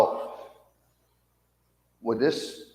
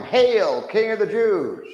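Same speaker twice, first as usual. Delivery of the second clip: loud, high mocking hail; mock shout; 11 semitones higher, 5 dB louder, slower than usual. Soldiers jeering at the prisoner, loud mock hail. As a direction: call it out to jeer the prisoner. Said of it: "they begin to call out to him".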